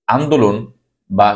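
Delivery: ordinary talk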